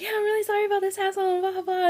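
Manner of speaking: high, rushed polite apology; forced cheer. Retold or imitated. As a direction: rattle it off with put-on pleasantness. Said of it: "I was trying to smile".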